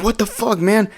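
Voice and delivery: high-pitched mock outrage; shocked protest. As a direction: imitate a guy just punched, voice jumping way up. Punched man protests in retold fight.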